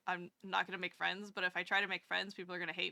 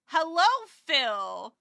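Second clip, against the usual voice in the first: slow and loud, higher, a long sung-out hello; drawn-out greeting; 5 semitones higher, 9 dB louder, much slower than usual. Real, not acted. Streamer greeting Phil in chat, drawn-out and excited. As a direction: stretch the greeting out, delighted.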